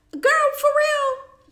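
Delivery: high, slow, stunned squeal; a stretched, high-pitched "for real?"; surprised. Real, not acted. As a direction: drag it out in disbelief. Surprised by the notification.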